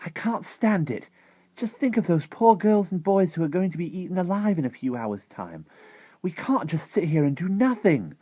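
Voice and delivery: put-on high, loud girlish outburst; loud, high-pitched desperate plea; distressed cry. A narrator's reading. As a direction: cry out in helpless indignation. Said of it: "stood up and cried out".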